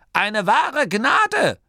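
slow, high, loud granny voice; stern granny. A narrator's reading. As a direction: proclaim it slowly in a high voice. Granny's cutting comment. (energische, hohe Oma-Stimme)

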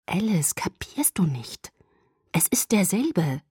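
slow, hushed insistence; urgent and drawn out, making someone understand; insistent. A narrator's reading. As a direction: stress it slowly, half-whispered at first. Urgent explanation after whispered revelation.

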